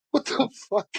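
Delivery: high, stunned what the fuck; retold disbelief. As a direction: gasp it out in disbelief. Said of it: "I'm like".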